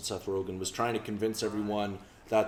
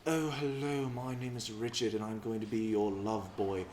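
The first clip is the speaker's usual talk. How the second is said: soft, suave put-on British accent; imitation of a film-star British accent; Hollywood British accent; 5 dB quieter than usual. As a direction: play the British romantic lead. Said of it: "British men, like, especially, like, in Hollywood".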